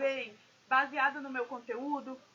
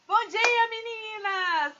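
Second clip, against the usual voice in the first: high, loud and drawn-out greeting; projected, rousing greeting; 7 semitones higher, 9 dB louder, much slower than usual. Shown as a demonstration. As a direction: call out to the group with a big, projected voice. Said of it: "a imposição da voz ajuda muito".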